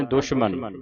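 ਦੁਸ਼ਮਨ with "sh", dush-man; sh sound. Retold or imitated. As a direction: hush the middle of ਦੁਸ਼ਮਨ into sh. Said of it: "ਬਿੰਦੀ ਲਾ ਕੇ ਉਚਾਰਨ".

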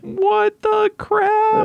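high, slow, drawn-out disbelief; surprised. Real, not acted. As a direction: stretch it out in shock. Tribesmen suddenly appear.

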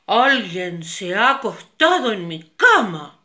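loud big-bear voice; papa bear loud. Acted. Papa bear finds his bed used, loud big voice. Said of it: "el oso grande dijo".

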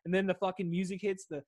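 normal talking voice

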